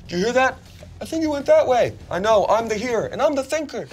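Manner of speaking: high, goofy voice of dumb knights; dim knights voice. Acted. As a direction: play them as bumbling guards.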